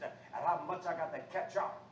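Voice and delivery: ordinary talk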